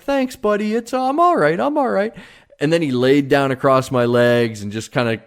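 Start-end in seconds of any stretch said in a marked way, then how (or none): 0.0-2.1 much higher, tender reassurance to the dog; tearful reassurance. Retold or imitated. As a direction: soothe the worried dog while fighting tears. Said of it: "I'm like".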